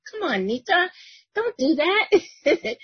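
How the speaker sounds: high, slow, drawn-out pleading; whiny protest. Retold or imitated. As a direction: stretch it out like a teasing protest. Retelling his reply to sister.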